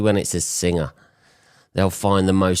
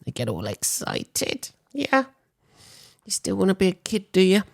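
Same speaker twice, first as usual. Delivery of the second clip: high, mocking voice for a childish fan; mocking geek voice; 14 semitones higher than usual. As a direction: sneer at the fan in a high, put-on voice. Mocking Star Wars geeks.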